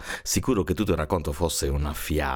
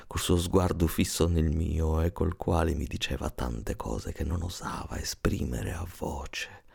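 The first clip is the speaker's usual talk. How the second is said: ordinary delivery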